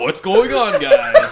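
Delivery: loud laughing podcast greeting; excited. Shown as a demonstration. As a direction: shout it with a laugh. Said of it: "Let's make it more fun".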